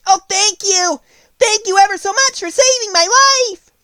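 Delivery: high, loud squeaky mouse voice; a tiny grateful mouse squeaking its thanks; squeaky little voice. Acted. Field mouse thanks the Woodman in a squeaky voice. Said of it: "it said in a squeaky little voice".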